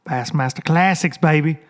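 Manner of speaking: usual speaking voice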